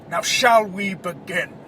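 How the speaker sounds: slow, deliberate, lightly menacing; emphatic villain line. Retold or imitated. Podcaster imitating Harrison's catchphrase, emphatic and slow. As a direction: lean into it like a villain in his cell.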